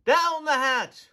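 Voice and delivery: the whole crew roars it back; crew shout. A narrator's reading. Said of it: "we all shouted".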